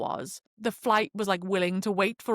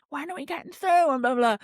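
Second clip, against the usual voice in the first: stressed couple; 6 semitones higher than usual. Retold or imitated. Voicing the stressed young couple.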